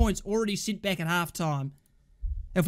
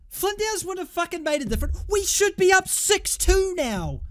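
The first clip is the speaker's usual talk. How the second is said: high, strained protest; exasperated outburst; 10 semitones higher than usual. Real, not acted. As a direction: wail it in frustration, slowing on the last words. Exasperated fan arguing missed chances.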